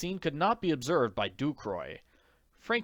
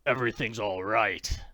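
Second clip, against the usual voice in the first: growled. Acted. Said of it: "growled Frank".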